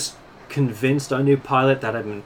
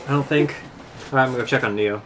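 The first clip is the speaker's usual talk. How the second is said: ordinary talk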